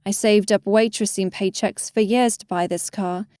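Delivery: ordinary delivery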